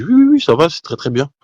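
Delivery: high, breezy oui oui oui; strangely carefree reply to a doctor; forced cheerful yes. Retold or imitated. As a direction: brush off the bad news brightly. Calm reply to doctor's bad news.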